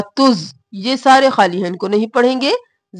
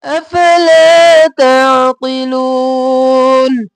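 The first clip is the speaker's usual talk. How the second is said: slow, louder melodic recitation; Quran recitation; 3 semitones higher, 6 dB louder, much slower than usual. Shown as a demonstration. Teacher recites the next verse for students, slow and melodic. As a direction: chant each word long and even.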